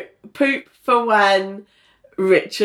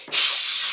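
cat's hiss; 6 dB quieter than usual. Shown as a demonstration. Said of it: "I would wanna be like".

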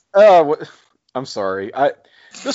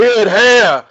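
put-on Jersey accent; 10 semitones higher, 9 dB louder than usual. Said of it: "Jersey accent".

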